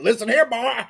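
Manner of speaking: high-pitched Alabama scolding; Alabama accent. Acted. Podcaster playing himself confronting filmmakers, Alabama accent. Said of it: "if they would have been Alabama folk".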